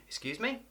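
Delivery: soft, high "Excuse me?" rising on "me"; light, quick questioning lift; polite query. Shown as a demonstration. Didn't hear someone, soft high polite question. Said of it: "So the intonation would be".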